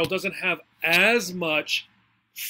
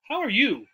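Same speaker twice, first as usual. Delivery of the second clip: "you" stressed and raised, then falling; 5 semitones higher than usual. Shown as a demonstration. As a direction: return the question, leaning on "you". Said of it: "stress the part that's about me".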